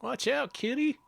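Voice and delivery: squeaky high echo of the warning; high silly voice. Retold or imitated. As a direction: pitch it way up, playful. Echoing partner's line in silly voice.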